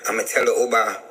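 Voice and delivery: normal talking voice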